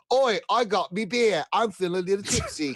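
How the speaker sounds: a hammed-up British drinker; lightly put-on British accent; mock British accent. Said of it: "That was a British accent".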